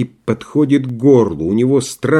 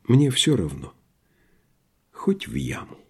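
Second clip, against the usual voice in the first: resigned; 7 dB quieter than usual. A narrator's reading. Doctor ready even for the pit, resigned. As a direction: let the voice drop away in surrender.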